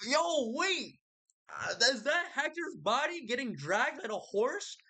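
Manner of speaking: very high, hammed-up wail of shock; mock crying disbelief. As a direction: wail it like a horrified onlooker, played for laughs. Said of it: "They're all just crying".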